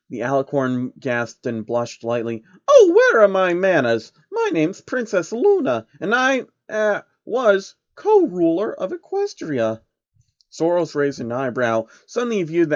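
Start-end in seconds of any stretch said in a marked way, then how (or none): 2.7-9.8 high, bashful princess voice; a shy pony princess catching her manners; high and a bit flustered. Acted. Luna voiced.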